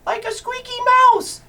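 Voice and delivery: very high squeaky voice; squeaky mouse voice. Pretend. Teacher previews the mouse voice.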